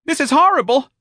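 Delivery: loud, high-pitched horrified squeal; squeaked. A narrator's reading. Mister Gonzalez is appalled by the letter, squeaking. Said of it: "Mister Gonzalez squeaked".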